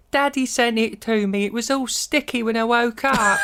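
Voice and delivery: high-pitched imitation of a creepy little boy; creepy child. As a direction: lift it into an eerie kid's voice. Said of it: "he says some creepy things".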